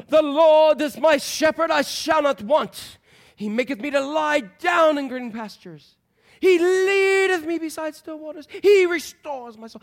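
grand, high, loud Shakespearean declaiming, slow at first. Retold or imitated. Drama professor performing Psalm 23 for the class in a grand, loud Shakespearean voice. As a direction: boom it out like a stage actor. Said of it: "he put on his best voice, Shakespearean voice".

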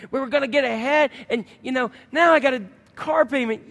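hammed-up woe-is-me complaint; self-pitying whine. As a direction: whine about your bad luck. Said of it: "with the woes".

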